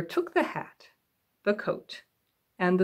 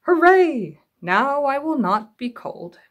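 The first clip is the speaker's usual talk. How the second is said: loud, much higher hooray, then slow; excited cheer; 6 semitones higher, 6 dB louder, slower than usual. Acted. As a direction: cheer like a happy cub. Little Bear cheers.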